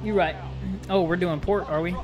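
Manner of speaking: ordinary talk, nothing marked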